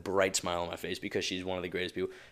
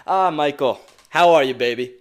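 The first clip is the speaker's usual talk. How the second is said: loud, higher call across the room; retelling the housekeeper's cheerful shout to him; imitating Evelyn calling; 5 semitones higher, 11 dB louder than usual.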